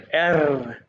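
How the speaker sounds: एर with the tongue vibrating, a trilled r; rolled R. Shown as a demonstration. Teacher showing the German R to students, tongue rolled. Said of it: "जुबान को vibrate करना है".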